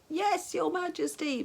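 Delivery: high, slow loud reply; bellowed. A narrator's reading. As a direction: bellow it for the deaf old king. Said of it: "bellowed the attendant".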